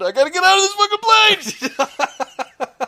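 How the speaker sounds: high, slow, desperate cry; a passenger mid-panic trying to escape the plane; frantic passenger. Retold or imitated. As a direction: play a man who has lost it and must get off.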